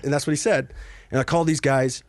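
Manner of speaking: ordinary talk, nothing marked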